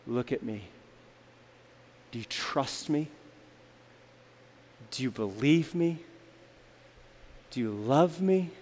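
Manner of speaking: very soft, low, gentle questioning; a parent quietly reassuring, pausing between questions; calm, hushed. Acted. Parent kneeling to a child, calm and hushed. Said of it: "he spoke in a calm whisper".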